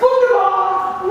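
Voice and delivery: high, loud, drawn-out devil; gloating Satan. Retold or imitated. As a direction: stretch it out in villainous delight. Satan pleased.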